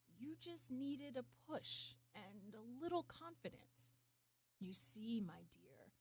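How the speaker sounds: gentle, fading to a whispered my dear; soft wise encouragement, trailing off; tender words sinking to a hush; soft fading tenderness. Acted. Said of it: "The wise man chuckled and said".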